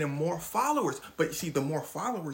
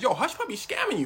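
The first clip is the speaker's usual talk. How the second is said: snitching partner; 7 semitones higher, 6 dB louder than usual. Retold or imitated. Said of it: "calls the guy".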